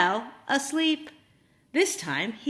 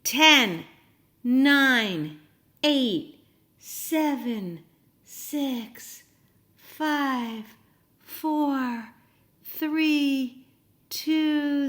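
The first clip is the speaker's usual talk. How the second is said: countdown; 2 semitones higher, much slower than usual. Shown as a demonstration. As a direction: call out the countdown so children count along. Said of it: "Help me".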